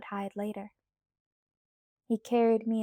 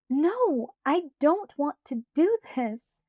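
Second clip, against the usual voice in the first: louder, slower, stubborn; louder slow refusal; 5 semitones higher, 6 dB louder, slower than usual. A narrator's reading. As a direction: refuse Alice, dragging the words. Bella refusing.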